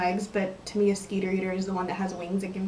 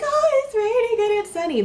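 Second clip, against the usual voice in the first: high, loud mock childlike wonder; young self; 13 semitones higher, 6 dB louder than usual. Vlogger imitating younger self seeing sun and rain, childlike voice. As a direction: exclaim like an amazed little kid, hammed up. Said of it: "when I was young".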